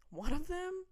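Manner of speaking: normal talking voice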